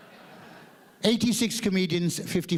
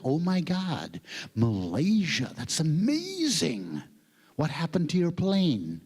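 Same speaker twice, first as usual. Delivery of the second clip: drawn-out mock American amazement; American organizer; slower than usual. American organizer hearing of Malaysia, hammed-up amazed drawl. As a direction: imitate an American gushing slowly. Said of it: "And he was like".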